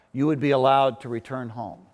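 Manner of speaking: ordinary talk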